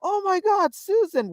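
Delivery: high-pitched retold blurt; instinctive yell. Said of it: "I just instinctively yelled".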